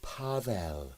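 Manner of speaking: put-on soft, weary girl's voice; a hushed, dancer's soft voice. A narrator's reading. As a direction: murmur the name like a tired dancer. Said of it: "put in the small tornado".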